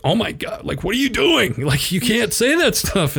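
high, hammed-up alarmed dad; dad's alarmed reaction. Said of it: "my dad's like".

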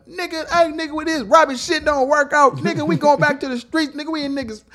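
character voice. Retold or imitated.

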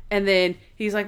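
normal talking voice

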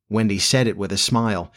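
usual speaking voice

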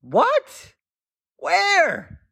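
high put-on gunman voice, baffled; kidnapper confused. Gunman to kidnapped mom, high and baffled. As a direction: ask it high and puzzled.

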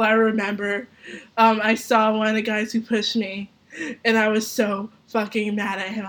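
ordinary talk, nothing marked

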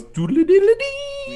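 loud, very high comic trill; imitated eyebrow-raise jingle. Wishmaster's eyebrow going up, comic sound effect. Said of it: "one eyebrow raises and goes".

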